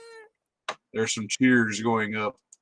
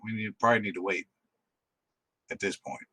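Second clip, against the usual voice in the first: hushed; 5 dB quieter, faster than usual. Acted. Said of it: "she kinda shushed him".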